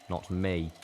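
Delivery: usual speaking voice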